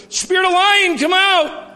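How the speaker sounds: high, loud mock preacher command; mock loud deliverance. Mocking the man who prays loudly over people in church, in a high, loud mock command. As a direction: ham up a brash exorcism in front of everyone.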